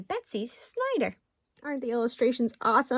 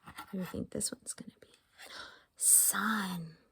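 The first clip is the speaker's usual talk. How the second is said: playful secret whisper; hushed teasing question; 3 semitones lower, 9 dB quieter than usual. Acted. Guessing game whisper.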